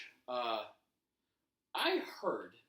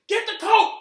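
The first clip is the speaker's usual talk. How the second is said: high, very loud barked order; imitated yelling; 21 dB louder than usual. Staff ordering poor helper at ringside, yelled. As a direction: bellow it at a hapless helper. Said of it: "got yelled at".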